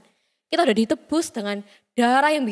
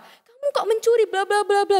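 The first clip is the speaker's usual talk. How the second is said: much higher-pitched mock scolding; angry mother scolding; 8 semitones higher than usual. Mother catches her little child stealing, mock angry scolding. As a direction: act out a mother telling off a child. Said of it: "ibunya marah-marah".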